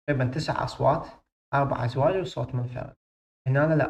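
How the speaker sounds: usual speaking voice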